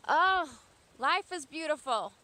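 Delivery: loud, high burst of joy; exuberant. Real, not acted. Urging viewers to live an amazing life, exuberant. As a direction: exclaim it loudly, high with delight.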